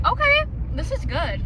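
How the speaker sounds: high excited tasting reaction. Real, not acted. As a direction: squeal it after the first sip. First sip of fall drink.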